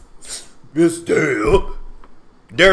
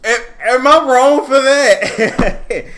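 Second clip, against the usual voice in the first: laughing high squeal; 8 semitones higher, 7 dB louder, slower than usual. Real, not acted. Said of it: "laughing question to viewers".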